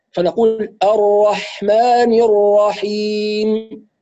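الرحمن الرحيم recited very slowly with a heavy doubled ra; recited example. Shown as a demonstration. Teacher reciting an example phrase for student. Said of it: "هكذا".

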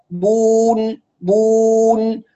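بون held slowly on one even u; pure long waw. Shown as a demonstration. Teacher corrects for students, pure long waw. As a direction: sustain بون evenly, straight into n. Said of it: "هكذا".

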